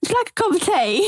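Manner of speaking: mock-British teatime voice; British accent. Shown as a demonstration. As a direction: put on a playful British accent for a demo. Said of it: "working on her British accent".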